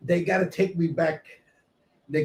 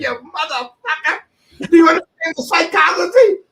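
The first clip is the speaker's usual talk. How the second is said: higher, much louder shout; retold angry retort; 11 semitones higher, 8 dB louder than usual. Beaten-up friend cursing Frankie on the walk, shouting. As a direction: throw the insult back with a loud shout.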